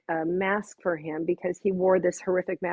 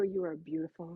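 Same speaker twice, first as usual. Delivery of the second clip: tender hush; 2 semitones lower, 9 dB quieter than usual. Retold or imitated. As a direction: say it softly to him, heart to heart. Tender moment at the mirror.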